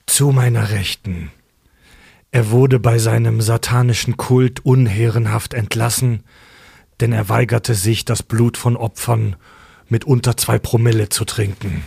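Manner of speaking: low, slow, dark ceremonial voice; ominous announcer. Acted. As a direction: introduce the cult members gravely. Ceremonial dark host intro.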